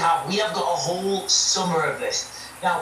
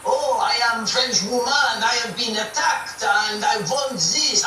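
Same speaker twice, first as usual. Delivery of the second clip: lightly put-on French accent for a little French woman, higher and slow; mock French accent; 6 semitones higher, slower than usual. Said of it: "He does the French accent very good".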